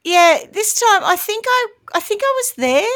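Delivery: high, tentative put-on voice; mock unsure witness. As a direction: play a witness guessing at her own story. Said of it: "This time to say that".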